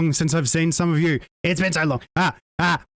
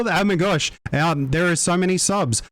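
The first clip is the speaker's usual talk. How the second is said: ordinary delivery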